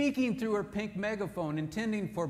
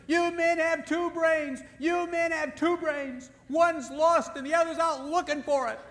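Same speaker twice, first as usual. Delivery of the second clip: high, loud, slow mock scream; mock screaming wife; 11 semitones higher, 6 dB louder, slower than usual. Wife screaming at husband over the diet book, high mock scream. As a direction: scream it at him, finger pointing. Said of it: "she's screaming at him".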